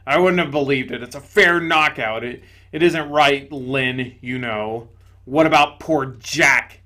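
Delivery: loud, gruff ghost exclaiming; indignant big ghost. Acted. Big ghost to the bright spirit, loud indignation. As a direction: blurt it out loudly, amazed and offended.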